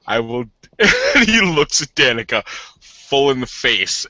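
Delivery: usual speaking voice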